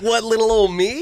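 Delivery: high, coy feigned astonishment; mock surprise. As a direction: play falsely modest surprise. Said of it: "he's, like, surprised".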